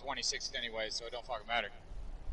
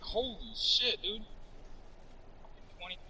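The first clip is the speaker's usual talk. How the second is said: higher and slow, drawn-out disbelief; mock astonished crowd; 8 semitones higher, 4 dB quieter than usual. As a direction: stretch it out like you can't believe it. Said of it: "Everybody there was like".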